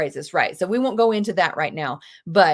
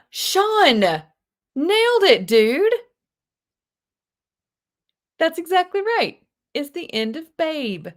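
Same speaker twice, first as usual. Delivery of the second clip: much higher, loud, cheering; excited praise; 8 semitones higher, 5 dB louder, slower than usual. Real, not acted. Teacher cheering a student's right answer, excited.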